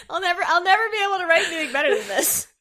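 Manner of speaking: laughing mock pride. Real, not acted. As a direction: laugh through the boast.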